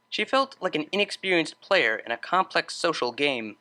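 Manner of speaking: usual speaking voice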